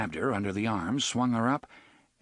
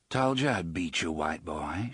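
low husky voice; 4 semitones lower than usual. Acted. Susanna teases Eddie in his arms, low husky voice. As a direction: purr it close to his ear. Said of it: "she said in a low, husky voice".

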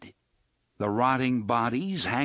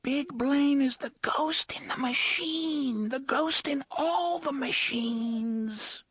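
put-on high, slow, breathy child's whisper; slower than usual. A narrator's reading. Hidden child warning the travellers, slow high whisper. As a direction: whisper it slowly like a frightened ghost child. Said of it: "the unseen voice whispered".

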